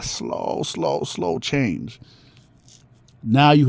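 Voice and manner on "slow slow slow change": low, soft, very slow stretched words; drawn-out slow. Real, not acted. Drawing out how slow change was.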